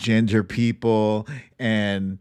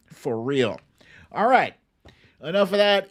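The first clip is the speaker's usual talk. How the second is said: ordinary talk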